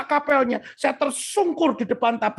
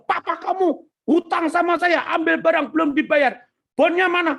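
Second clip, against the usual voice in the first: higher-pitched retold angry demand; angry creditor; 6 semitones higher than usual. Supplier confronts debtor's son in the office, angry. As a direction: demand the debt in mock anger. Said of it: "lalu marah-marah di kantor".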